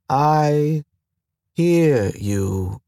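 newborn station AI, words slow and oddly spaced; each word placed with care, gaps between. Acted. Station AI answering the pilot, slow and oddly spaced. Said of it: "The words were slow and oddly spaced".

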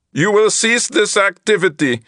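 high flat alien translator; monotone translator voice. A narrator's reading. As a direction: speak like a flat machine translator. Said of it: "the monotone translator voice".

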